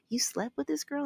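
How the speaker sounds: a friend gasping at gossip; shocked disbelief. Retold or imitated. Friends react to his story.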